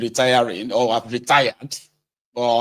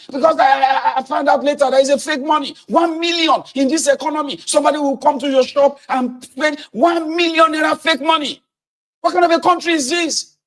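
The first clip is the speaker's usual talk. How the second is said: much higher, loud and flustered rant; angry trader; 4 dB louder than usual. Acted. A cheated trader yelling at the interviewer, furious. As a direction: sputter it out in fury. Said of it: "I said".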